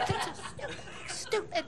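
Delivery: high, strained whisper with a laugh; hammed-up frustration, kept under the breath; hushed venting. Parents venting in the bedroom, whispered exasperation.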